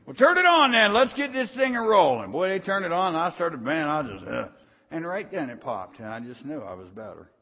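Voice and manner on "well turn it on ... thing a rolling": staff calling out to get the machine rolling, loud and high; loud retold call.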